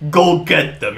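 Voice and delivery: loud mock command to the infected; mock shout. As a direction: yell it like a hero unleashing a zombie. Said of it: "he's like".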